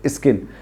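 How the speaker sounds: स्किन said softly, starting right on the s; no added i before स्किन, just a soft s-k; clean skin. Shown as a demonstration. Teacher softly models स्किन with no extra vowel. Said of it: "इतना ज्यादा ई मत करिए".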